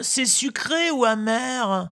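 put-on high, slow, whining child; whiny tattling little sibling. A narrator's reading. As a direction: whine it like a sulky little kid. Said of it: "d'une voix pleurnicharde". (voix pleurnicharde d'enfant)

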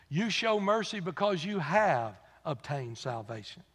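normal talking voice

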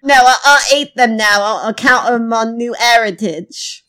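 hammed-up fake British accent; put-on British accent. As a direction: welcome listeners in a badly faked British accent. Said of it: "adopting a foreign accent".

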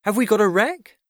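high, loud eager question; urgent cry. A narrator's reading. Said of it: "cried Father".

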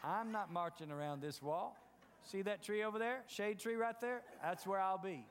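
low, soft, laughing mock of a shirker; lightly voiced lazy worker dodging the march; mocking lazy skeptic. Preacher mocking people who skip the march, low laughing voice. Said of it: "natural-minded people on the first day".

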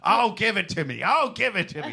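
imitating a raspy moaning voice; mock raspy groan, laughing. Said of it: "that shitty raspy voice".

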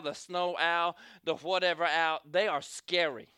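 usual speaking voice

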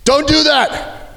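urgent guard yell to stop a fight. Retold or imitated. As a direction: bellow "Don't do that!" with urgency. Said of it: "I have to yell at them".

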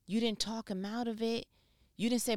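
ordinary delivery

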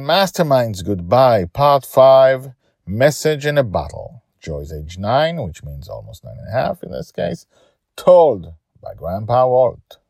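loud, slowed episode title read; title announcement. Acted. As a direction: announce the episode like a show opener.

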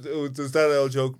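ordinary delivery

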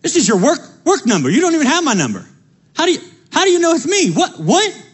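높은 목소리로 놀란 척, 빠르게; 번호도 없는데 어떻게 알았냐며 놀라는 척; surprised, mock disbelief. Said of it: "I was like".

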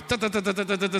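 rapid-fire "ta-ta-ta" burst; imitated machine-gun fire. Preacher showing the church is trigger happy, imitated gunfire. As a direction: rattle off gunshots with the tongue.

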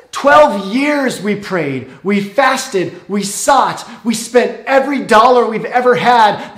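rising to a loud, high, anguished shout; exasperated outburst at God. Retold or imitated. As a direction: cry out at God in disbelief, building to fury. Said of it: "she was thinking".

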